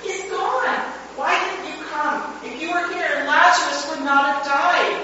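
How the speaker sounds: high, grieving cry at his feet; high grief-stricken lament. A narrator's reading. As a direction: let the grief spill over as you plead. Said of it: "crying out".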